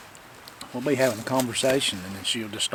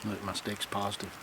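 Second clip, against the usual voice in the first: normal talking voice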